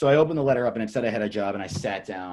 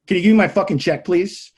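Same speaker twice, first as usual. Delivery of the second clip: loud, raised, fed-up retort; unrestrained anger; 7 semitones higher, 7 dB louder than usual. Retold or imitated. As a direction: act out letting rip at the boss. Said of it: "I'm not holding back at all".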